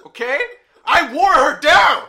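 loud, high joking yell; mock shout. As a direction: yell it with a laugh. Joking outburst about his mom.

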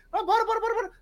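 high, insistent begging; pleading friend. Retold or imitated. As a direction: beg them to come play. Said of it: "retold friend insisting".

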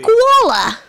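loud, high-pitched Aussie exclamation; mock Australian accent. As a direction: call out the animal as a hammy Australian. Riffing in mock Australian voices.

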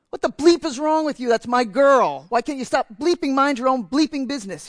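loud, high angry screaming; retold furious scream. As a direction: yell it at her rescuer, furious. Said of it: "he screamed".

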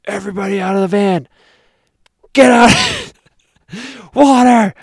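high, loud panicked yelling; freaking-out shout. Retold or imitated. Father to family in the burning van, freaking-out shout. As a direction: order everyone out in a frantic yell. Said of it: "I was freaking out".

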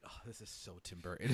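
low, soft mock aside with amusement; mimicked reaction. Teasing Jen by quoting her movie-theater reaction, mimicked low aside. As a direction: imitate a companion's remark, dropped low. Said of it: "I didn't say it like that".

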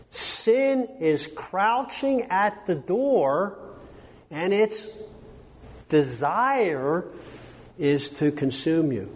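slow, high-pitched solemn warning; dramatic scripture quotation. Acted. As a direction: draw each phrase out with weighty pauses. Quotes God's warning to Cain, slowly.

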